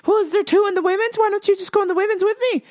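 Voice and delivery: high, hurried, insistent lady voice; pleading lady impression. Lady coaxing him toward the women's restroom, mock fretful. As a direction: imitate a fretful woman talking fast.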